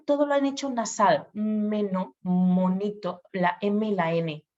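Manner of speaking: ordinary delivery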